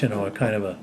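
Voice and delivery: ordinary delivery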